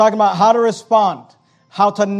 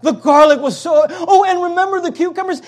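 higher, wistful imitating voice; mock nostalgic people; 9 semitones higher than usual. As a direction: caricature how people romanticize the past. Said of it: "This is how people talk".

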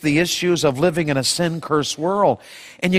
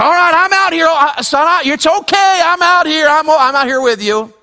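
reassuring father; 8 semitones higher, 4 dB louder than usual. Acted. Preacher voicing father comforting son.

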